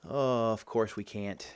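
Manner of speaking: ordinary talk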